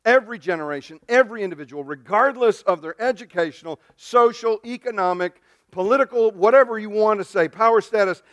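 loud, rising preaching build; full-force sermon crescendo. Acted.

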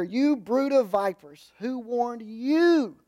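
slow, pressed and weighty, each word leaned on; a prophet's emphatic accusation, drawn out. Retold or imitated. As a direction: rebuke them with full emphasis.

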